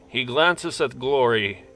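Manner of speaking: ordinary talk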